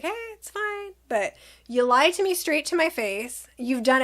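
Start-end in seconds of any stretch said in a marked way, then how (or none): 0.0-0.9 high, soft, sugary reassurance; smooth it over sweetly; lightly voiced sweet brush-off; kind and sweet. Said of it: "trying to be kind and sweet".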